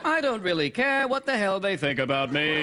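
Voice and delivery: mock presenter lilt, high then falling; British TV presenter. Sidekick answers host about audience, falling British presenter cadence. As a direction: slide grandly down through the sentence. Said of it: "talk like someone on television in Britain".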